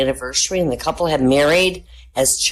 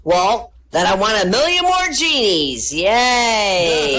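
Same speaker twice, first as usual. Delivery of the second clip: gleeful hiker; 5 semitones higher, 3 dB louder, slower than usual. Acted. Hiker speaks.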